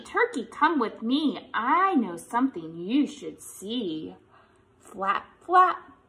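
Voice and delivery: normal talking voice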